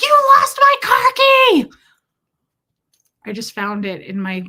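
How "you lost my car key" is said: high, loud mock accusation; accusing yell. Retelling how she blamed Tommy, high loud yell. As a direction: shriek the blame at him. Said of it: "I was like".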